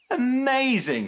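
very high, thrilled exclamation; joyful shout. A narrator's reading. Snip thrilled by his dad, joyful shout. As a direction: shout it, holding the middle high. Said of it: "shouted Snip".

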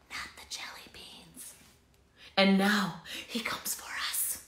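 fearful hushed whisper; scared whisper. Acted. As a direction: whisper it in dread. Frightened whispering characters.

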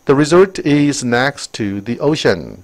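very slow, clear English read; slow textbook reading; slow reading aloud. Shown as a demonstration. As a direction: read it out word by word for the class. Reads English sentence aloud.

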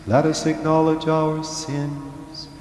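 ordinary delivery

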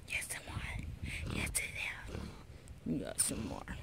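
slow whisper to the dog. Real, not acted. Owner whispering to her dog, slow. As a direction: breathe it slow and secret.

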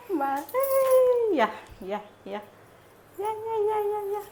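high, loud sing-song calling. Real, not acted. Mother calls baby to her.

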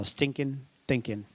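drawled Arkansas "stinking thinking", soft and low; Arkansas twang. Shown as a demonstration. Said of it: "that's more Arkansas".

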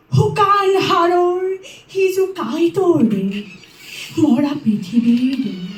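ordinary talk, nothing marked

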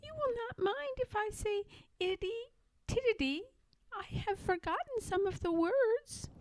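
lightly piggy and pert; little pig voice. Acted. Pigwig offers to sing for Pigling, high and pert.

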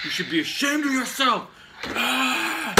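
high, indignant scolding into a scream; outraged yell. Acted. Fan shaming the studio over a renamed ship, outraged yell. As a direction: scold them, then erupt.

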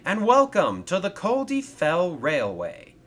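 high, loud welcoming announcement; cheerful welcome. Acted. Engine welcomes Thomas to his railway, cheerfully. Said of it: "he said cheerfully".

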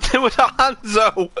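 high, loud cry through laughter; squealed through real laughter; laughing shriek. Real, not acted. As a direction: exclaim it while cracking up. Shrieking with laughter at game surprise.

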